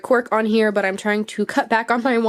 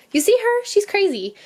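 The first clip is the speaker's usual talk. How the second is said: excited; 11 semitones higher than usual. Real, not acted. Laughing at the rat's antics.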